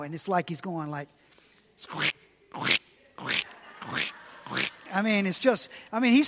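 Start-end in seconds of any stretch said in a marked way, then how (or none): 1.8-4.7 breathy squeak, squeak of soggy steps; soft imitated squelch of soaked sandals; whispered squeaking noises. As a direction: mimic wet shoes squeaking, under the breath. Soggy Jonah walking, imitated noise.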